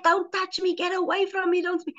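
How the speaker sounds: high-pitched mock panic, no real volume; retold screaming. Man fleeing the praying speaker, mock scream. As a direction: imitate frantic pleading without shouting. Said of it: "but he was screaming".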